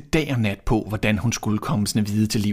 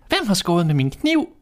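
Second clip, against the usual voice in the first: a pitched-up little dwarf complaining; dwarf's voice; 8 semitones higher than usual. A narrator's reading. Sixth dwarf asking who used his knife, higher voice. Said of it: "sagde den sjette".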